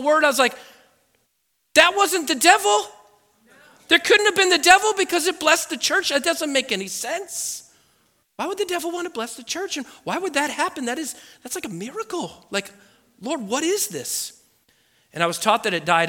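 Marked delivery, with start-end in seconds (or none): 1.7-7.7 high-pitched disbelief; acted bewildered astonishment; incredulous realization. Acting out his astonished realization. 8.4-14.4 high, softened voice of wonder; acted awestruck questioning; hushed amazement. Acting out his awed questioning.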